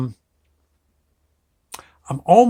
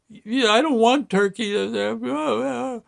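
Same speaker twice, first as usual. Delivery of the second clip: high-pitched mock blubbering; little boy's refusal dissolving into pretend sobs; protest, then trail into fake crying; imitating crying child; 7 semitones higher than usual. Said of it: "the waterworks really started".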